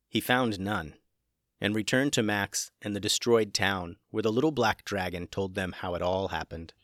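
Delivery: plain reading voice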